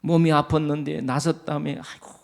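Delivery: a sugary blessing to a recovered elder; fond congratulation. Shown as a demonstration. Preacher shows greeting a recovered church elder. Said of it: "같이 즐거워하시기를 바랍니다".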